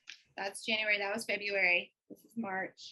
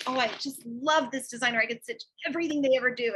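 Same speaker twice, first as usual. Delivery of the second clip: bright, quick, louder gush; gushing excitement; 3 semitones higher, 7 dB louder than usual. Retold or imitated. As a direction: gush over a new favorite designer.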